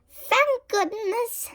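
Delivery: high, slow, relieved sigh; high relieved witch. A narrator's reading. Winnie after the roof stops leaking, high relief. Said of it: "Winnie said".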